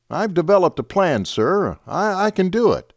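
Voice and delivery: put-on a young lieutenant eagerly offering a plan. A narrator's reading. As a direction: pitch it up, keen to prove himself.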